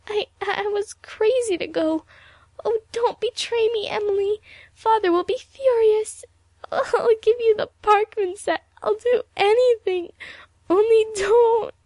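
high, loud tearful begging; starting to cry. A narrator's reading. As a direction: plead desperately, voice climbing toward sobs. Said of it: "Evelyn began to cry".

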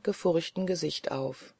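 ordinary delivery

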